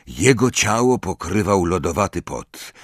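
ordinary delivery, nothing marked

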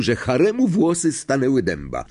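ordinary delivery, nothing marked

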